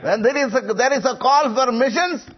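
insistent sermon delivery, pitched well up; forceful preaching. Real, not acted. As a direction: press the point home like a revival preacher. Exhorts congregation at full force.

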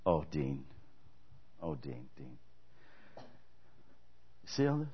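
Jesus gently showing a servant his fruit; a loving master, soft and low; tender Jesus. Retold or imitated. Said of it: "and he's gonna go".

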